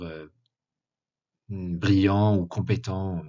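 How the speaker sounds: ordinary talk, nothing marked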